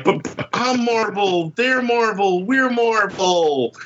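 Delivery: put-on high, slow childish whine; whiny protest. Arguing with his bosses, high, slow childish whine. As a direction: plead like a sulking child. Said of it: "And I'm like".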